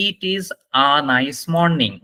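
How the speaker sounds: slow model sentence with "a" said as "ah"; "a" pronounced আ. Shown as a demonstration. Teacher showing students the sound of single a, slow demonstration. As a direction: say "a" as an open "ah", slowly. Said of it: "ওইটার উচ্চারণ হবে আ".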